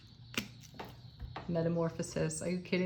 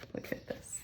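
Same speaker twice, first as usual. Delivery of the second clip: an awed whisper; hushed whisper; 12 dB quieter than usual. Real, not acted. Reader whispering at a card she just drew. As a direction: barely breathe it, amazed.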